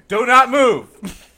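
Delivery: loud, drawn-out police order; each word slow and forceful; slow loud cop command. Pretend. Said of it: "See, that was better than him".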